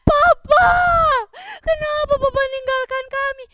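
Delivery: loud, high wailing lament; hammed-up sobbing cry; crying widow. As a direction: wail in acted grief. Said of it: "dia nangis".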